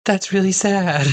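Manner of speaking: high, pitying voice; real sympathy. Real, not acted. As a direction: react with a high-pitched ache of sympathy. Reacting to a tragic story.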